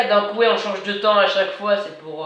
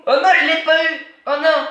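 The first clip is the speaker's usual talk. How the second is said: frustrated outburst; 7 semitones higher, 5 dB louder, faster than usual. Real, not acted. Missed the tag in game.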